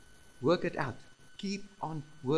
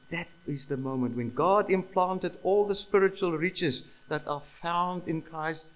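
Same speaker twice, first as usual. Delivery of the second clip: ordinary talk, nothing marked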